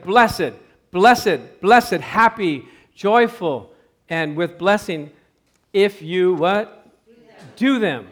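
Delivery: loud, emphatic pulpit delivery; emphatic preaching. Acted. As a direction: hammer each blessing home. Preaching the blessing.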